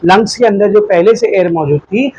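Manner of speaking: ordinary talk, nothing marked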